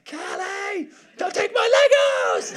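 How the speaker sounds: put-on very high-pitched little boy shriek; imitated child's yell. Father imitates his son yelling at little sister, high-pitched outraged yell. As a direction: yell like an outraged small brother. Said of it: "you just hear Charlie yell".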